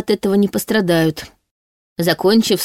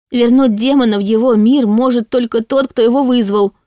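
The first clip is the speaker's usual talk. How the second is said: higher-pitched urgent cry; rattled-off shout; 5 semitones higher than usual. A narrator's reading. Heroine halts the advancing demon, shouting it hurriedly. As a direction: blurt it out in one breath. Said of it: "скороговоркой выкрикнула я".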